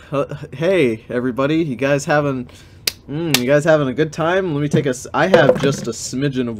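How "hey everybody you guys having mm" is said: plain reading voice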